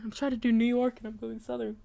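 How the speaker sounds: quiet aside. Real, not acted. Quiet aside about accent.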